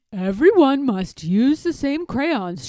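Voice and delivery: strict teacher. A narrator's reading. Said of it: "she said".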